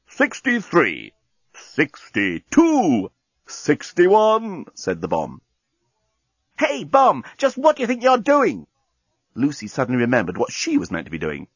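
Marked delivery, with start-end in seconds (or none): 0.1-4.6 slow, drawn-out countdown; bomb counting down. Acted. 6.6-8.7 high-pitched, indignant demand; indignant challenge. Acted. Journalist challenges the bomb.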